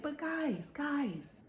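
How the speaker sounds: high, soft appeal to two friends; lightly acted coaxing; mock pleading. Belle pleads with the bickering pair.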